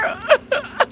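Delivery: high-pitched burst of laughter. Real, not acted. Laughing at her own fake complaint to viewers. Repeats joke, laughs again.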